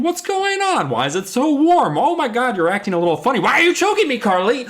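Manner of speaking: high, rising alarmed voice; mock panic. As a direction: play the friend suddenly being choked. Retold friend being choked, alarmed.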